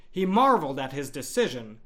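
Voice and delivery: usual speaking voice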